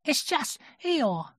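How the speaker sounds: high, slow little-creature voice; small high-pitched character voice; Piglet. A narrator's reading. Piglet comforting Pooh, in a high little voice.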